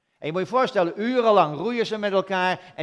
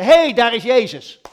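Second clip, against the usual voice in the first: excited call; 4 semitones higher, 10 dB louder than usual. Pretend. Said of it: "zeiden ze".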